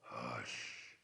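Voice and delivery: very low, soft rumbling hush; deep bear hush. Acted. Bear silencing the rabbit, deep low hush. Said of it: "Picks up that rabbit".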